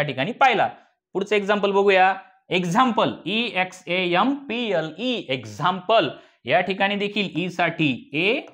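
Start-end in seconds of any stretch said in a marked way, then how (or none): none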